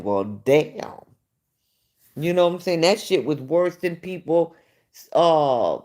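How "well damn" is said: unimpressed reaction. Retold or imitated. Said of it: "I was like".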